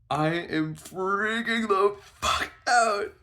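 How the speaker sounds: high, loud, drawn-out wail; freaking out. Retold or imitated. Panicking after she texts him, wailing. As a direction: stretch every word in loud panic.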